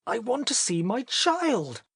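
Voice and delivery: very high, desperate demand; frantic father at the verge of collapse; almost shrieking. A narrator's reading. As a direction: nearly shriek it, rising in panic. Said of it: "Mister Button almost shrieked".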